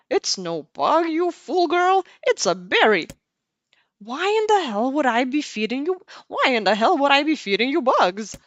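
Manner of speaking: put-on loud scolding old granny; grandma voice. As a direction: scold her like a cranky granny. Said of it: "I do my best with my grandma voice".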